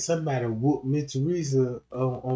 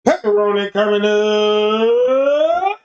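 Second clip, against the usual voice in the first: louder, slow, stretched out; loud drawn-out call; 4 semitones higher, 8 dB louder, much slower than usual. Acted. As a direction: belt it like a clerk calling an order. Pizza clerk calls back loudly.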